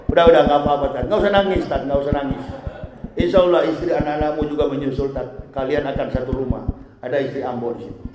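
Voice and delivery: calm a crying man tenderly; gentle comfort. Acted. Angel comforting the dead preacher, soft and soothing. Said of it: "lembut sekali".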